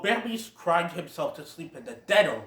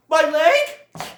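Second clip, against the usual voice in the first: much higher, louder yelp; whining hurt dogs; 11 semitones higher, 8 dB louder, much slower than usual. Retold or imitated. Dogs kicked by the Great Prince, mock yelping. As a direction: cry out in mock pain. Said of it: "have them going".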